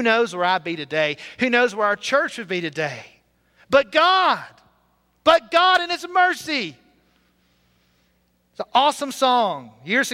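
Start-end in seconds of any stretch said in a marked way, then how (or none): 3.7-4.4 "But God" rung out higher and louder; a triumphant, ringing "But God"; proclaimed. Real, not acted. Climax of testimony, proclaimed. 5.2-6.8 "But God" rung out higher and louder; a triumphant, ringing "But God"; proclaimed. Real, not acted. Climax of testimony, proclaimed.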